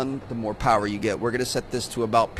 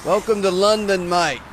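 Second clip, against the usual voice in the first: put-on a jokey, not-quite-right Australian accent, a bit higher and louder; bad Australian accent; 6 semitones higher, 5 dB louder than usual. Train driver greets London in a mock Australian accent. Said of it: "I don't know why I sound Australian".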